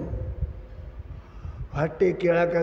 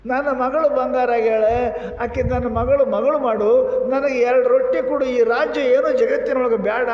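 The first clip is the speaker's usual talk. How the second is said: higher, louder wailing appeal; weeping plea; 8 semitones higher, 5 dB louder than usual. Acted. Starving king crying to Kubera at night, wailing plea. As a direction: beg the god through tears. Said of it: "ಒಂದು ಸಾವಿರ ಅಳಕ್ಕೆ ಚಾಲು ಮಾಡಕತ್ತ".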